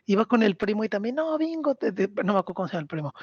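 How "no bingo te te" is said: put-on higher, fast, bossy kid voice; a child ordering her sister about; imitating a bossy little girl yelling; mock yell. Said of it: "le empieza a gritar".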